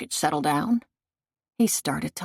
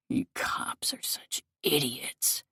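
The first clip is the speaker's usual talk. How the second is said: slow, hushed contempt; whispered sneer; 2 semitones lower, 7 dB quieter, slower than usual. A narrator's reading. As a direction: hiss it slowly at the cops. Killer sneering at cops.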